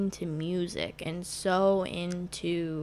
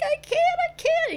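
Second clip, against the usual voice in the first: much higher, louder panic; panicked; 5 dB louder than usual. Retold or imitated. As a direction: squeal in terror on the ride. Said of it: "And I'm like, I'm like".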